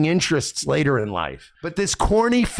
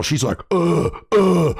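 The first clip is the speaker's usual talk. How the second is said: mock moaning.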